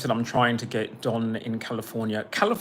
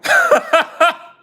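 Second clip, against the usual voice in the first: loud laugh; 10 dB louder than usual. Real, not acted. Bursts out laughing on stream.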